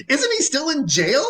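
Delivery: high-pitched incredulous question with a laugh; mock astonishment, bubbling into laughter; amused disbelief. Teller questions the casting, amused.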